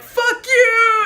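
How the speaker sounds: put-on high, loud kid's jeer; mock defiant yell. Little kids giving chef the finger, high mock yell. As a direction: yell back cheekily at a grown-up. Kids yelling back, retold.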